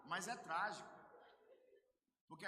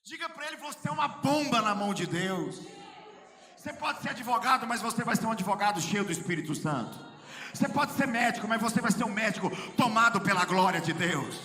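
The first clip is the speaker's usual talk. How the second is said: gritando com fervor, bem mais alto e agudo; pregação inflamada em crescendo; preaching at full force; 6 semitones higher, 23 dB louder than usual. Acted. (pregação exaltada aos gritos)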